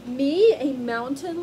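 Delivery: a lion pondering slowly; musing lion. Acted. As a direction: turn the idea over, amused. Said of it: "Lion mused".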